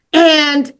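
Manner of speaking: ordinary delivery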